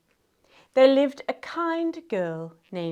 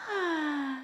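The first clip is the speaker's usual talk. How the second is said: soft, relaxing breath out; sigh; 3 semitones higher, 10 dB quieter than usual. Shown as a demonstration. Storyteller invites children to sigh, showing a soft sigh. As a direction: sigh softly and let it fall. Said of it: "Can you give me a sigh?".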